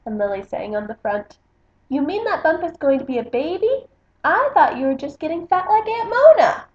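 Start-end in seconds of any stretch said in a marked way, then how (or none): 1.9-6.6 lily's voice. Acted. Lily's mean quote read aloud.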